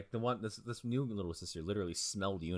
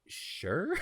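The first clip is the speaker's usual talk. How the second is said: hesitant mock consent. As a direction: imitate someone unsurely agreeing, pitched up. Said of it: "fucking Yuna's like".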